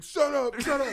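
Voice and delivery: high, loud shouted order; cop shouting. Retold or imitated. Cop jabbing prisoners, shouting. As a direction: yell it while jabbing. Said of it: "and he's like".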